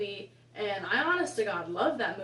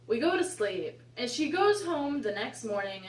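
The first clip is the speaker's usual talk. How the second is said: ordinary talk, nothing marked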